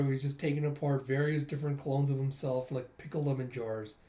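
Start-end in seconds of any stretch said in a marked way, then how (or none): none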